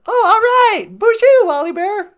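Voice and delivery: chirpy robot sidekick offering a ride home; high, squeaky sidekick voice. Pretend. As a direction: squeak it like a tiny robot pal. Voicing the companion.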